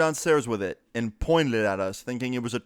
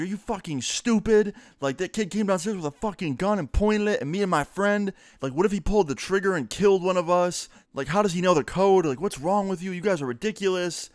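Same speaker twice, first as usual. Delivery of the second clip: higher, heated ranting at his mother; chewing mom out; 8 semitones higher than usual. Retold or imitated. AJ chewing out his mom over the gun, furious rant. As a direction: tear into her, furious and incredulous. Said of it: "bitch his mom out so hard".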